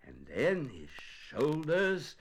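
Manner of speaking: plain reading voice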